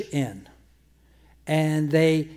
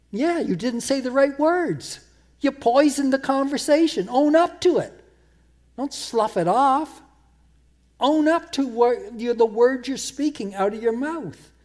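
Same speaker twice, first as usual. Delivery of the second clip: raised, pitched-up preacher's rebuke; preaching at full force; 8 semitones higher, 3 dB louder than usual. Acted. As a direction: drive it home to the congregation, higher and louder.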